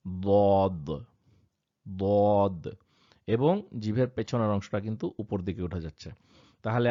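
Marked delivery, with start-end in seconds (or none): 0.1-2.7 heavy emphatic দদ with tongue pressed up; ض spoken with emphasis; Arabic letter dad. Shown as a demonstration. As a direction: say দদ thick and heavy, back of tongue raised. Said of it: "এখান থেকে উচ্চারণটা হচ্ছে".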